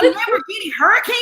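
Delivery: high, fast, laughing disbelief; amazed squeal. Retold or imitated. Retelling the Lamborghini reveal to her friend, laughing high disbelief.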